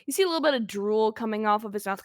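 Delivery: usual speaking voice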